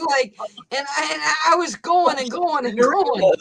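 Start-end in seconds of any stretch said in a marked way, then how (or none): 1.5-3.3 louder and higher, half-shouting; worked-up shout. Real, not acted. Host retelling her rant, half-shouting. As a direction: get fired up reliving the rant. Retelling how she ranted.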